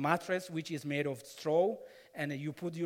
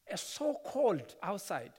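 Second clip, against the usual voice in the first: ordinary delivery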